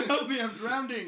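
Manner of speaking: laughing fake cry for help; mock drowning cry. Joke about drowning pool.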